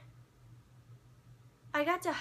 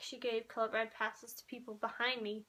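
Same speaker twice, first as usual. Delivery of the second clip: ordinary talk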